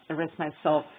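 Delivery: ordinary delivery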